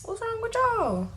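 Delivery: high, carefree strolling-in voice; mock carefree Michael. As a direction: play it like nothing happened, casual and high. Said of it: "just coming in the house like".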